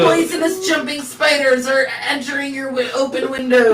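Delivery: high hammed-up trailer voice; dramatic announcer. Mocking a news alarm about jumping spiders, high and hammy. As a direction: sell it like a scary movie trailer.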